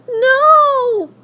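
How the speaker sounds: very high, loud cry; anguished cry. Acted. Abilene crying out at the warthog's death, loud. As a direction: cry out in protest.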